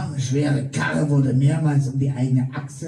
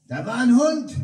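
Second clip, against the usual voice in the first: leicht gespielter Ruf, etwas höher; Zuruf; 9 semitones higher than usual. Retold or imitated. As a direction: ruf es den anderen zu. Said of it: "Jemand rief". (ein Passant ruft, leicht gespielt)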